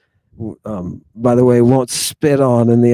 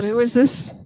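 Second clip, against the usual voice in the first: normal talking voice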